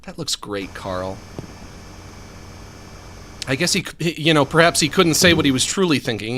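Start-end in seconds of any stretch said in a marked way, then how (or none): 0.0-1.2 low, hushed patronising praise; Jimmy. Acted. Jimmy humouring Carl about his drawing, soft and condescending. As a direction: drop it down as if soothing a toddler. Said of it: "why did he say it like that?".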